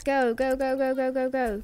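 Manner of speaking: rapid-fire chant of go; urgent cheering on. Real, not acted. Player racing a thirteen-second countdown, urgently egging on. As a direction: urge them on against the clock.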